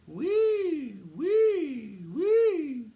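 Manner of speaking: high loud siren wail. A narrator's reading. Mouse waking the dog with a loud siren wail. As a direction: sound the siren good and loud. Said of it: "Good and loud".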